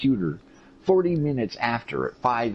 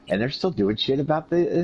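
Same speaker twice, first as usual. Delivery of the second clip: ordinary talk, nothing marked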